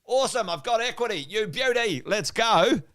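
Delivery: high, eager cheer; excited equity holder. Acted. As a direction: act out an elated property owner celebrating. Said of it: "we're all sitting here right now going".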